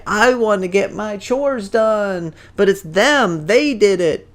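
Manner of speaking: high, drawn-out whine, loud; whiny blaming. Acted. Billy blames his friends to Mom, whiny blaming. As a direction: whine like a kid dodging blame.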